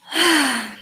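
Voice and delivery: long audible sigh; sighing before going on. Real, not acted. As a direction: let out a hesitant breath. Hesitating over a touchy topic.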